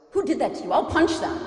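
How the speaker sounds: put-on fiery kid ready to fight for her mom; protective outburst. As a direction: daughter vowing to punch whoever hurt mom, loud and high. Twelve-year-old daughter defends crying mom.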